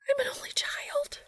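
panicked whisper-shout; whisper yelling. A narrator's reading. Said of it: "she whisper shouted".

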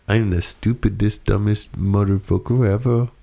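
put-on British-style accent; self-mocking line in a British-style accent. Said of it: "That's a pretty good accent".

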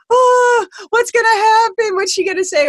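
high, loud panicked inner voice; a jittery high-pitched inner panic; anxious squeal. Retold or imitated. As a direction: squeal it like a nervous kid.

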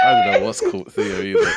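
high-pitched, laughing through the words; helpless laughter. Real, not acted. Co-host cracking up at a friend's antics, laughing hard.

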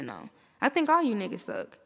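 ordinary delivery

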